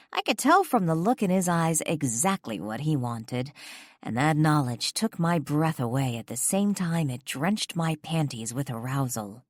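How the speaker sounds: ordinary delivery, nothing marked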